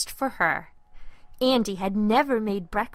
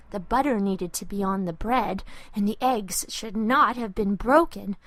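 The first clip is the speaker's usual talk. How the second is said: plain reading voice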